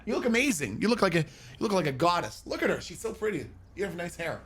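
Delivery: softly cooing to his partner; tender cooing. Real, not acted. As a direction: sweet-talk her gently.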